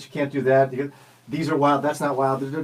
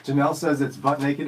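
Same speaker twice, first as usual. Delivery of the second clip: ordinary delivery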